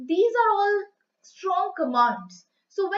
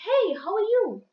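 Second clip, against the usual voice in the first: higher-pitched, mildly excited hello; excited greeting; 5 semitones higher than usual. Shown as a demonstration. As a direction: greet someone you are excited to meet. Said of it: "you are very excited to meet someone".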